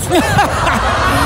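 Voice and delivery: high giggle at own pun. Real, not acted. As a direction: burst of high laughter.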